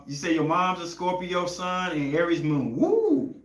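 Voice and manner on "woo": high, whooping woo; sudden excited whoop. Real, not acted. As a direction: let out a big excited holler. Reacting to Aries moon placement.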